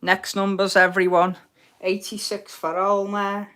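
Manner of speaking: ordinary talk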